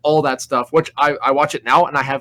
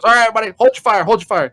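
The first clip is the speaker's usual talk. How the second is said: higher, louder barked order; drill instructor; 8 semitones higher, 6 dB louder, faster than usual. Retold or imitated. Drill instructor halts the knife throwing, loud and higher. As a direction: bark it like a drill sergeant stopping the range. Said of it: "so then the drill instructor says".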